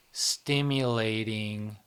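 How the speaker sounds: normal talking voice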